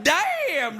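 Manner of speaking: high, long-held exclamation; drawn-out mock amazement. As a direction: stretch it out in comic astonishment. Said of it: "like that".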